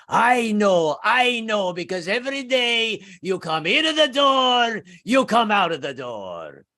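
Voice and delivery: put-on high, loud, furious old-world landlord yelling; screaming Italian landlord. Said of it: "screaming at the top of his lungs".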